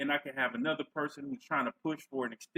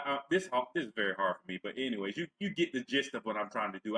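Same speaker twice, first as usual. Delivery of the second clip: normal talking voice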